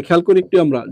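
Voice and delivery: ordinary delivery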